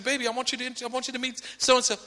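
lightly voiced parent introducing their child; quick, eager parent making introductions; parent coaxing child. Parent introducing a suddenly shy daughter, coaxing.

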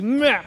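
put-on high whiny baby "meh"; baby whimper. Father mimicking his baby son refusing the bottle, high whine. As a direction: imitate a fussing baby's cry.

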